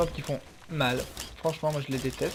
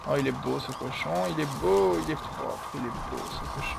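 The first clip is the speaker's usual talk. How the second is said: doting on the pig. Real, not acted. Gamer cooing over his pig companion, doting. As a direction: coo over it like a proud owner.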